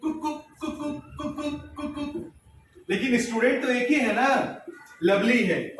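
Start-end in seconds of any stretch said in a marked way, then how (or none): 0.0-2.3 soft, high repeated cuckoo; little hushed 'ku-ku' hoots in quick pairs; imitated 'kuku' calls. Shown as a demonstration. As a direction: imitate a small bird call, quiet and higher. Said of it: "कभी ये आवाज निकाल रही है".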